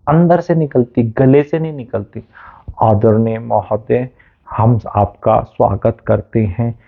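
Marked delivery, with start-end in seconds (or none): 2.8-6.8 slow, lower, pompous ceremonial address; mock a stuffy school-stage welcome; stiff formal announcer. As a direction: parody of formal compère. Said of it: "फिर ऐसे".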